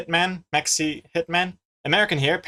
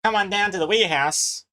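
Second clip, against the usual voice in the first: higher-pitched attempt at a Kiwi accent; 5 semitones higher than usual. Shown as a demonstration. Streamer showing chat a stock phrase, in a Kiwi accent. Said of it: "try and do a Kiwi accent".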